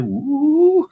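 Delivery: a sudden high, loud woo of joy; excited whoop. Real, not acted. Streamer whooping to chat over United's transfers, high and loud. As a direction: let out a big celebratory woo.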